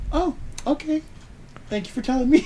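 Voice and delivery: a lightly voiced, high, politely stunned mom. Mom answering her son's news, in a high prim voice. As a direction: put on a stiff polite mother. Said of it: "totally locked in by societal convention".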